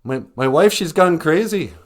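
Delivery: high, loud frantic 911 caller; panicked caller. Acted. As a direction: plead down the phone in panic. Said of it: "guy calls nine one ones".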